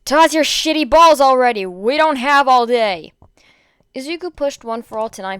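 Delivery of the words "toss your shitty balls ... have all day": loud, furious shouting taunt; angry yell. A narrator's reading. Bakugo taunting the enemy team to throw, furious yell. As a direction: bellow it at them, fed up with waiting. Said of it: "Bakugo growled".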